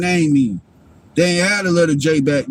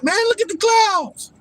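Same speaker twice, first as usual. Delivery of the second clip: much higher put-on voice, hammed-up awe; mocking gawking people. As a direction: squeal it like a dazzled fool. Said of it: "People like".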